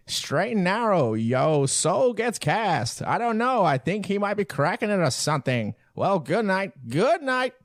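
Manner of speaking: put-on high, slow fifties-kid voice; drawn-out 1950s narrator. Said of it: "You're doing it in your fifties voice".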